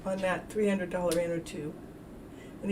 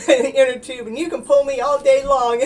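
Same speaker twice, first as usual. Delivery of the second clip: laughing; 3 semitones higher, 8 dB louder than usual. Real, not acted. Laughing about the inner tube rides.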